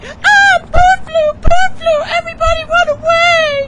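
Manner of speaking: very high, loud mock-panic shriek; hammed-up screaming panic; panicked bystander. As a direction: shriek a comic alarm to the crowd. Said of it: "there's some asshole who's always like".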